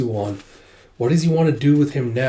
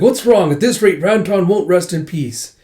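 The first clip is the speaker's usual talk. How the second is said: raised, louder reveler's call; rowdy urging; 6 semitones higher, 5 dB louder than usual. Retold or imitated. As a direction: keep rallying the crowd in a high voice. Revelers keep urging the crowd on.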